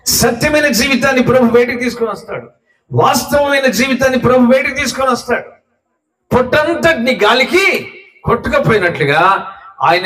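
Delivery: high-pitched, driving sermon delivery; impassioned preaching. Acted. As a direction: preach it urgently, pitched well up. (ఉద్వేగంగా, హెచ్చు స్వరంలో ప్రసంగం)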